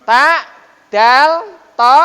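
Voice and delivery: Ta, dal, to said loud and separate; tajwid letter sounds. Shown as a demonstration. Teacher sounding out letters of one type. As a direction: call out each letter sound clearly. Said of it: "Ta dengan to ini satu jenis".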